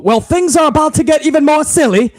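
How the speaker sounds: a hint of the announcer's deadpan; quoting the commentator. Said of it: "Kevin Patrick on commentary said, and I quote".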